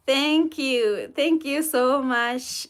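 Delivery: ordinary talk, nothing marked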